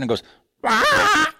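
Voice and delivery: high squeaky toy squawk; rubber chicken squeak. Retold or imitated. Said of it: "squeezes us out like that rubber chicken".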